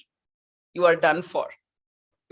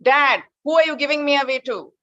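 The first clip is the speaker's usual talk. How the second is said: louder, higher insistent repeat; pressing question again; 7 semitones higher, 5 dB louder than usual. Retold or imitated. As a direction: push harder, he's being ignored. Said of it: "he refused to look back".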